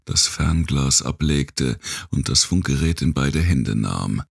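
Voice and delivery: ordinary delivery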